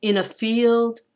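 "In a" light, FIELD held long and high; two quick unstressed syllables, then lean on "field"; anapest. Shown as a demonstration. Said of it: "two unstressed and a stressed".

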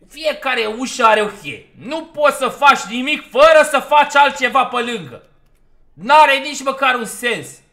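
loud, high-pitched exasperated rant; frustrated shouting. Real, not acted. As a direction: yell out your annoyance at the game. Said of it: "ce joc frustrant".

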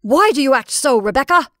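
loud, high, fierce challenge; almost fierce. A narrator's reading. Caroline challenging her sister's odd behaviour, almost fiercely. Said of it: "almost fiercely".

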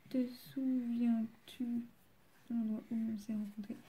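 very slow, stretched syllables while writing; slow, absent-minded self-dictation; dictating to oneself. Real, not acted. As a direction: sound it out as you write. Says the words while writing them.

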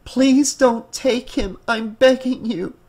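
high, drawn-out begging; desperate pleading. Acted. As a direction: plead with them not to take your child. Mother pleads for her son.

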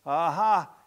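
loud triumphant aha; triumphant exclamation. A narrator's reading. Husband finding the bedbug, loud triumphant exclaim. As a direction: pounce on the find. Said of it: "exclaims the husband".

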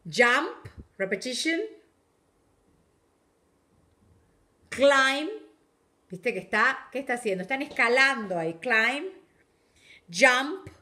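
projected teacher voice naming pictures; teacher projecting to the class; loud classroom call-out. Real, not acted. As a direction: call each word out to the back row.

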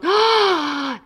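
high, loud delighted gasp of wonder; amazed oh. Retold or imitated. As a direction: gasp in awe at the dress. Staff gasp at the dress.

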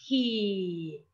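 كي drawn out on a gliding-down ee; long vowel. Shown as a demonstration. Teacher splitting the word for pupils, كي held long. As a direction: hold the ee of كي long, letting it fall. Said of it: "وأيضًا صوت طويل".

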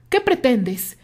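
a touch of angry challenge; demanding. A narrator's reading. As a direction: confront him with it. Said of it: "Terry le reclamó".

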